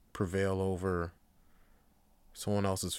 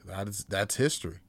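ordinary delivery

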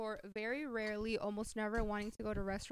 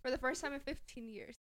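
normal talking voice

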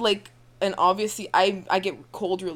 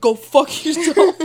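slow, loud, grumpy curse; angry; 7 semitones higher, 11 dB louder, slower than usual. Retold or imitated. Ten-year-old woken up, cursing.

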